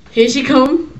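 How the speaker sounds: ordinary talk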